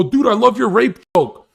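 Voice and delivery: high, loud gushing fan impression; mock excited blurting; overexcited fan. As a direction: imitate a fan who can't contain himself. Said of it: "get so excited that they go".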